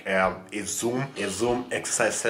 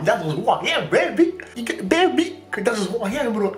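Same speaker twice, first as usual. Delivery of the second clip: put-on high playful baby; 12 semitones higher, 4 dB louder than usual. Playful self-description to viewers.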